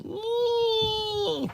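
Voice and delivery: very high shrill creature squeak; a tiny animal shriek; piercing squeal. Acted. Creatures make a sound.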